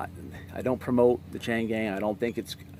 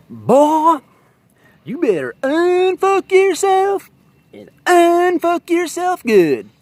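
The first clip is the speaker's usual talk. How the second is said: much higher and louder, slowed right down, a hammed-up Southern cop; hillbilly cop yell; 11 dB louder, much slower than usual. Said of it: "this one hillbilly cop".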